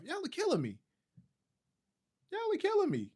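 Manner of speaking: high, exasperated cry; exasperated squeal. Real, not acted. To chat complaining about his audio, exasperated. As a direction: throw up your hands at the chat. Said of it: "You know what? How about now?".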